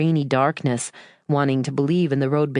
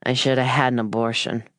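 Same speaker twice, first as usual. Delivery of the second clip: put-on slurred drunk mother; 3 semitones lower than usual. A narrator's reading. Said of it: "gravelly, slurred voice".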